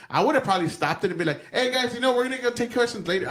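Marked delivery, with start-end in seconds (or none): 1.5-3.3 higher-pitched pretend staffer stepping in; lightly acted, raised-pitch call to the room; mock aide interrupting. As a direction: cut in like a handler ending questions. Acted stepping in to stop questions.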